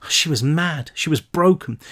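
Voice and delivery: ordinary delivery, nothing marked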